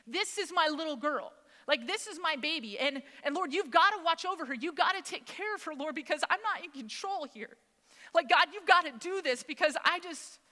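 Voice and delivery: high, urgent retold prayer; desperate pleading. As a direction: beg God as if losing control. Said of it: "begging God".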